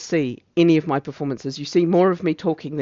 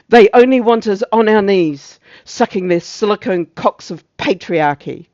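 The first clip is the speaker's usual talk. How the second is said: loud, high outburst that eases off; outraged declaiming; 5 semitones higher, 9 dB louder than usual. Acted. Officer at the climax of the tirade, loud and outraged. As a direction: blast the opening, then bite through the rest.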